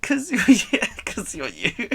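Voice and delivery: real laugh cutting into the words; breaking into laughter. Real, not acted. Streamer's pretend crying to chat collapses, laughing. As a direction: giggle through a high whine that falls apart.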